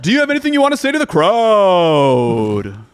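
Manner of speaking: loud, high booming host; fighting game announcer. Acted. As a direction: call out to the crowd like an emcee. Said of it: "The announcer voice".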